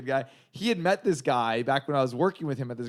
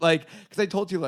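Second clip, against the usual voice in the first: usual speaking voice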